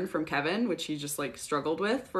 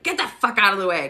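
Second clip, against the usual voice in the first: put-on gruff old man; 5 dB louder, faster than usual. Peter brushing Andre aside.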